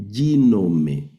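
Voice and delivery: "ginome" with a long, stressed "gi" and final E; first-syllable accent. Shown as a demonstration. Teacher demonstrating the Greek word, first syllable stressed. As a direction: lean on "gi" in "ginome", then fall to E. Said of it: "Aquí sería".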